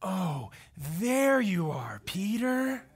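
slow, hushed, tender; little Lost Boy tenderly recognizing Peter in a hush; gentle recognition. Retold or imitated. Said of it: "he looks at him and goes".

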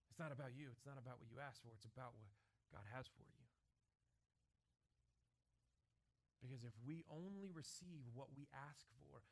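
low, soft, intimate sermon voice; hushed preaching. Acted. Preacher softly urging the congregation to be ready. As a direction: draw them in close and quiet.

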